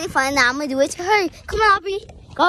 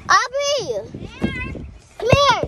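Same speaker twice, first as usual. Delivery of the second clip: high, loud call across the park; 10 semitones higher, 3 dB louder, slower than usual. Real, not acted. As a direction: call her over from far away. Calling friend across the park.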